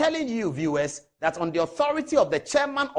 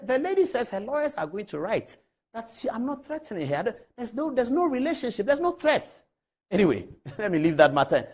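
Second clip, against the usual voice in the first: ordinary talk, nothing marked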